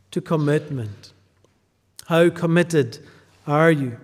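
ordinary talk, nothing marked

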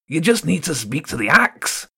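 a gleeful threat; sly rat threat. Acted.

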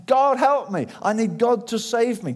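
loud, high retold plea; desperate acted prayer; crying out for help. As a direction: cry up to God to be saved. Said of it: "he cried out to God".

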